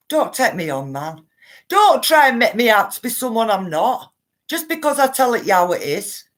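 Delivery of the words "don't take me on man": ordinary delivery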